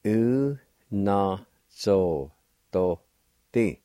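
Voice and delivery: u-na-zo-do-ti, each syllable slow and separate. Shown as a demonstration. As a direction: spell out u-na-zo-do-ti slowly. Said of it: "Second part".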